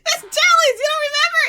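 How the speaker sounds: very high, loud excited squeal; giddy. Retold or imitated. Giddy sister reminding her cool sister about jellies, excited. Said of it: "I'm all, like, giddy and stuff".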